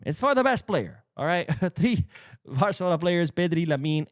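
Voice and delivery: ordinary talk, nothing marked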